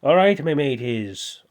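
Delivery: loud, drawn-out Irish accent; exaggerated Irish lilt, stretched out. Shown as a demonstration. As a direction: do a slow, big Irish impression. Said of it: "the Irish people's like this".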